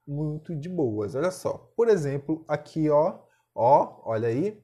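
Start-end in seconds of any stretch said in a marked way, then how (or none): none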